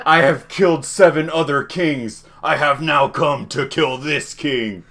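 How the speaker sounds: slow, measured big bad villain; Frieza. Big bad arriving to kill the king, villain proclamation. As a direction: declare it slowly like a big boss. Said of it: "the big bad fucking Frieza shows up".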